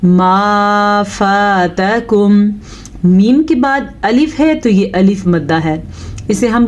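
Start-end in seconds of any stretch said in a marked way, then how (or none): 0.1-2.3 slow, drawn-out tajweed chant; slow melodic Quran recitation; Quranic recitation. Shown as a demonstration. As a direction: recite with long, measured vowels. Recites verse.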